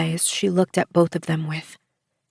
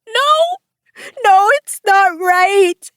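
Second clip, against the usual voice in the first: shrieking, much higher and louder, slow; desperate holler; 13 semitones higher, 6 dB louder, much slower than usual. A narrator's reading. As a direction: scream it, clinging on for dear life. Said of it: "Bishop hollered".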